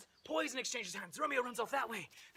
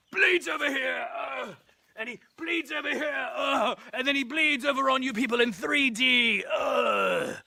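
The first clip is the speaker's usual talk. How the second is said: loud, raised narration broken by groans; hammed-up shouting; 4 semitones higher, 9 dB louder than usual. Narrator sprays blood over the audience, shouting theatrically. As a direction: bellow it with gory relish. Acts out Tybalt bleeding everywhere.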